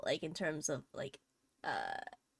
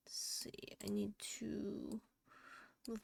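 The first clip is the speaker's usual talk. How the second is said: unvoiced, slow whisper to herself; 13 dB quieter, slower than usual. Real, not acted. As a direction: whisper it as an aside. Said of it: "muttering to herself".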